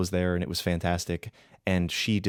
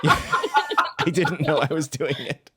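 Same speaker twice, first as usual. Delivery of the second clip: high, slow, laughing admission; 7 semitones higher, 4 dB louder, much slower than usual. Real, not acted. Player caught doing the voice unawares, laughing while admitting it. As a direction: laugh your way through the words.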